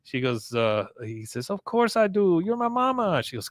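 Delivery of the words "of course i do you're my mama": pleading son. Retold or imitated. Con reassures his mother, retold.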